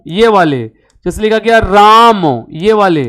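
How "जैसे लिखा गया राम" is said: राम stretched long and slow; drawn-out राम. Shown as a demonstration. Teacher demonstrating a stretched राम to students. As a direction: draw out राम over three beats. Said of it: "तीन गुना समय लगे".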